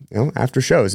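ordinary talk

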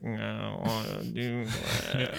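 soft, creaky caveman whine; mock a lazy caveman refusing in fry; soft creaky groan of a reluctant caveman; whiny vocal fry. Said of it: "when cavemen were like".

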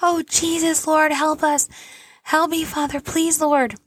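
high, pleading cry to God, partly retold; frantic prayer. Mom finding her baby not breathing, retold frantic high pleading. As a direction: mildly re-enact a panicked plea to the Lord. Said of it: "I started screaming".

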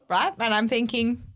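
normal talking voice

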